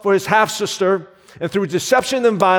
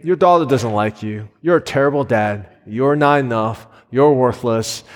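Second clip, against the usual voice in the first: voice of shame; 7 semitones lower than usual. Retold or imitated. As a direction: let shame murmur its cruel verdict, pitched down. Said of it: "I hear the whispers of shame".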